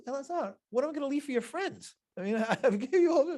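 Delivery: high, half-joking protest; a playful rebuke that is partly laughing; exasperated God. Retold or imitated. God answering Elazar's complaint.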